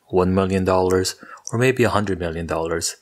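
normal talking voice

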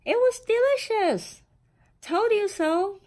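high, loud and thrilled; gleeful kid, told-you-so; delighted exclaim. Acted. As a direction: burst out with surprised delight. Children delighted after tasting.

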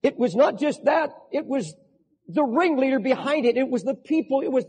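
voicing David's plea from the pulpit with mounting intensity; full-force preaching. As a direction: drive the sermon home with rising fervor.

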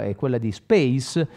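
ordinary talk

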